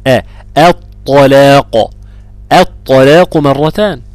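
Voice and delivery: الطلاق with a thin أ before the heavy ط; slow, loud الطلاق, opening vowel kept light; light hamza. Shown as a demonstration. Teacher drills another example for students, light hamza in الطلاق. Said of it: "الترقيق".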